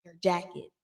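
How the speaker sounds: hushed, discreet mock whisper; imitated whisper. Dorit discreetly warning Denise about her coat, imitated whisper. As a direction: drop right down as if tipping someone off. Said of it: "she's whispering".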